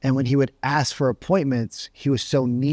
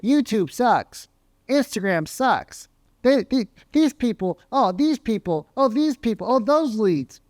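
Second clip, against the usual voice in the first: high, whiny complaining rep; mock whine of a lazy sales rep; whining salesman; 7 semitones higher than usual. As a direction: moan about the leads from way up high. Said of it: "are just gonna be like".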